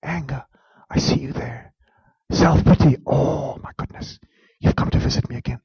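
quiet, mildly wry greeting; hushed. Shown as a demonstration. Preacher greeting his anger and self-pity, hushed. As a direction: greet your feelings softly. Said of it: "addressing own feelings".

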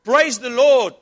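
high, very loud shouted praise; triumphant shout. Acted. Old lady shouting praise back at the atheist, very loud. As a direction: belt it out in victory. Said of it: "and she shouts".

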